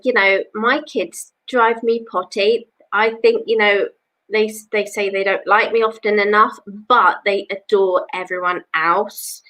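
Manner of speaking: normal talking voice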